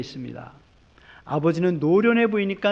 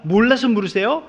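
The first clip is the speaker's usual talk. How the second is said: rhetorical question; 5 semitones higher, 6 dB louder than usual. Acted. Preacher repeats louder.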